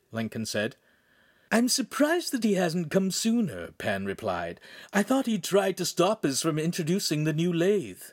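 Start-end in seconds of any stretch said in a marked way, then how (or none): 1.5-4.6 high-pitched voice for a story character; lightly puzzled, high character voice; Pan's character voice. Acted. 4.9-8.0 high-pitched voice for a story character; lightly puzzled, high character voice; Pan's character voice. Acted.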